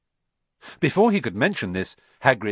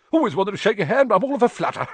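high, fast, giddy gushing; breathless with excitement; fluttery fan; 9 semitones higher, 5 dB louder, faster than usual. Acted. Admirer shaking Harry's hand, high giddy gush.

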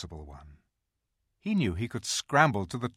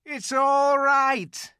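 high, loud, slow beaver call; a small beaver calling out with joy; shouting beaver; 9 dB louder, much slower than usual. A narrator's reading. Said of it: "he was shouting".

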